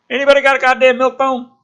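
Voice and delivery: high, fast, loud joking soldier voice; wisecracking soldier. Acted. Soldier joking to the group, fast loud wisecrack. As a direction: crack the joke to the squad.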